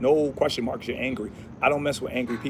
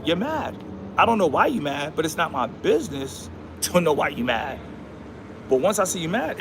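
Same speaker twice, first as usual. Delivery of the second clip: ordinary delivery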